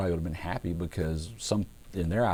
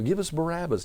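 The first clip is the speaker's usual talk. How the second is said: mock crowd yell; 10 semitones higher than usual. Preacher imitating the crowd before Pilate, high restrained mock yell. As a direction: call it out high, like a demanding crowd. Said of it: "they start yelling".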